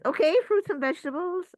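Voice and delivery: high, loud, fast chirpy suggestion; cheery body. Pretend. Body suggesting lunch, high fast chirpy. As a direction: chirp it like an eager helper. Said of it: "the body says".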